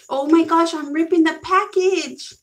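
oh no, ripping it while unboxing; alarmed. Real, not acted. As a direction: yelp as the box tears. Package tearing as she opens.

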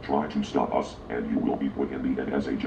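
low, stern announcer voice; deep menacing voice. Acted. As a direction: boom it out low like an authority. Announces that the show is shut down.

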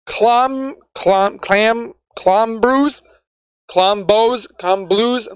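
trying out "Clom", "Clam", "Clombrews", "Clombos", "Clomblues" one by one; questioning lilt on each attempt at "Clombrews"; unsure pronunciation attempts. Real, not acted. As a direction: sound out versions of "Clombrews" haltingly, unsure which is correct. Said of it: "not quite sure how you wanna say it".

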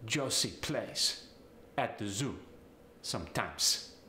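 staccato Italian accent, s for z in "Josie"; Italian "plays" and "sometimes" ending in hissed s, pitch falling. Shown as a demonstration. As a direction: break it into bits, Italian, "Josie" with an s. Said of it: "The Z sound gets the S sound".

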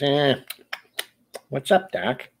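lightly voiced cartoon rabbit catchphrase; Bugs Bunny. Streamer doing a quick cartoon impression, lightly voiced. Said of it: "There's always Bugs Bunny".

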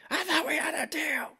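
very high strained voice in the background; hammed-up high mock yell; imitated angry chief. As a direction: act out an upset boss on the phone. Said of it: "you just hear him in the back".

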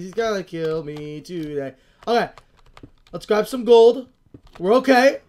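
ordinary talk, nothing marked

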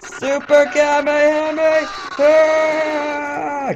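high, sustained battle cry; drawn-out anime attack yell. Pretend. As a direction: hold the attack name high and long. Calls out the special attack.

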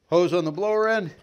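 ordinary delivery

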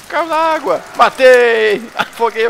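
cheering a lucky kill, high and loud; excited yell. Real, not acted. Drowns enemy, gleeful win.

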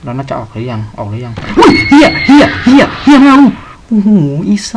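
loud panicked swearing as a monster appears; scared yelling. Real, not acted. Streamer startled by a monster, yelling in fright. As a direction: yell in real fright, voice strained. Jump scare in horror game.

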